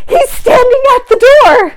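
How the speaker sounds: loud, high thrilled exclaim; excited shout. Real, not acted. Climax of retold romance, shouted.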